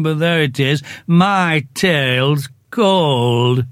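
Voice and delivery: slow, mournful donkey voice complaining; gloomy Eeyore. Acted. Eeyore grumbles to the others about his cold tail, slow and mournful. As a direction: drag each word out like a sad old donkey.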